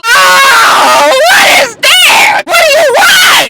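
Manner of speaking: very high, drawn-out imitated screech; mock screeching. Imitated tipsy bartender confronting people, mock high screech. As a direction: screech it out, hammed-up. Mimicking drunk Shay yelling.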